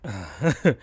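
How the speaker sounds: breaking up laughing; can't keep a straight face; real giggle fit; real laughter. Real, not acted.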